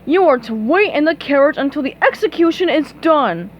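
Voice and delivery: booming royal father; lightly voiced stern sea king. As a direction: command her like a stern king. King Neptune voiced in story.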